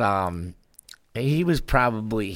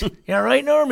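concerned dad; 6 dB louder than usual. Retold or imitated. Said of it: "he goes".

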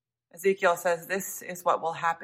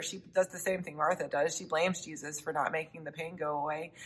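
normal talking voice

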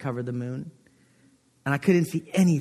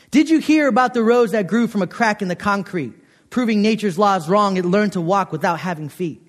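poem reading; 3 semitones higher than usual. Acted. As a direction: recite the poem with a little flourish. Reads [name] poem.

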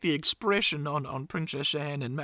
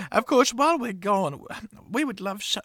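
normal talking voice

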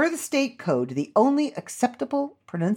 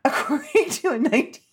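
laughing; 6 semitones higher than usual. Real, not acted. Trying to read while laughing.

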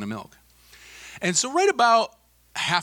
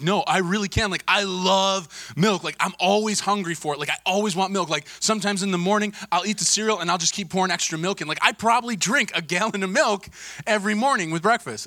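put-on higher-pitched, earnest boast of a teenager; imitated insistent kid; 6 semitones higher than usual. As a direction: protest like a kid who is sure he can. Kid insists he can drink it.

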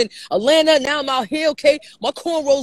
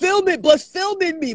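normal talking voice

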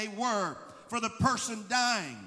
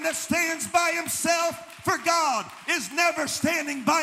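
loud, impassioned preacher building momentum; full-force preaching; 3 semitones higher, 4 dB louder than usual. Real, not acted. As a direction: drive it home to the congregation, raised and forceful.

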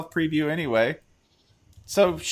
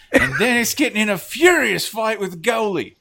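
lightly put-on European football pundit accent, laughing; a hint of a football pundit accent, higher; mock accent; 7 semitones higher, 3 dB louder than usual. Host riffing on the football chant in a mock accent. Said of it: "lead immediately to that accent".

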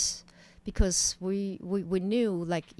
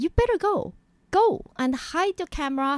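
urgent warning; 9 semitones higher, 4 dB louder than usual. Retold or imitated. Urging crew to flee.

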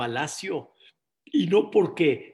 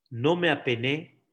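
ordinary delivery

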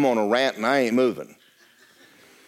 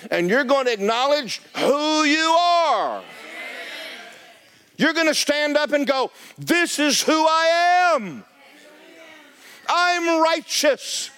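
preaching at full force; 11 semitones higher, slower than usual. Acted. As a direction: drive it home like a revival preacher.

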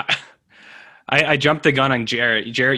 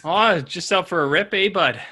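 lightly put-on Canadian accent, pitched up; higher, playful Canadian accent; mock Canadian slang; 5 semitones higher than usual. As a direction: play a chummy Canadian. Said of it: "That kind of stuff".

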